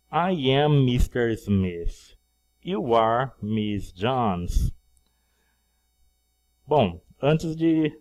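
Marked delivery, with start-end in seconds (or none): 0.1-4.7 slow, deliberate model sentence; slow, clear classroom model; slow model reading. Shown as a demonstration. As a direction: say it slowly for students to copy. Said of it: "teacher models the drill".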